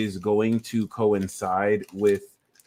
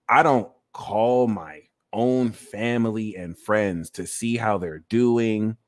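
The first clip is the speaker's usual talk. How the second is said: ordinary talk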